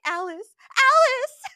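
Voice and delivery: hammed-up teary cry of a name; imitated teary voice. Reader imitating the audiobook narrator, mock teary voice. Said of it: "she has, like, a teary voice".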